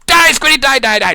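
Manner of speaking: high, loud, rapid-fire chant; mock battle cry. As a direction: play a gleeful maniac on the attack. Mock attack on squids.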